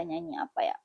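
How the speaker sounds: ordinary talk, nothing marked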